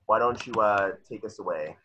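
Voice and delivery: normal talking voice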